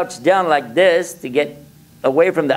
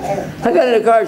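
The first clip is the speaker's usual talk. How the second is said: chuckling, pitched up; amused retelling; a laugh in the voice; 8 semitones higher than usual. Real, not acted. Amused at his luck.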